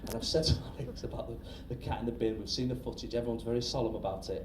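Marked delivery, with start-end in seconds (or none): none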